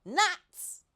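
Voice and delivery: high, loud monkey yelp; an eager monkey hearing of food; high squeaky cry of delight; excited monkey cry. A narrator's reading. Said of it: "cried the monkey".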